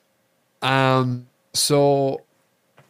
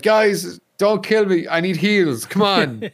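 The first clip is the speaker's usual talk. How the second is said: higher, louder begging; pleading; 8 semitones higher, 4 dB louder, slower than usual. Retold or imitated. Wounded player begging two scavs, mock pleading. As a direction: beg the scavs not to shoot you. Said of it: "I was like".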